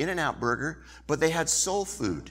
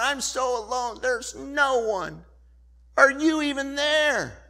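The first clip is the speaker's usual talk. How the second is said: higher, whiny self-pity; woe is me; 12 semitones higher than usual. Retold or imitated. Someone in a woe-is-me moment crying out to God, whiny. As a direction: wail it like you're the only one left. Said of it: "a woe is me moment".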